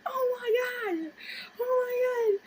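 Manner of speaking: high, loud panicked wail; shrieked mock terror; freaking out. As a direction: re-enact a hysterical meltdown. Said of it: "I started freaking the fuck out".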